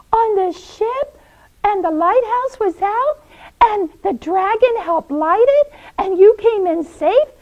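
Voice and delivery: high, rising disbelief; astonished questioning. Acted. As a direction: pile up amazed questions. Nora astonished by Paul's news.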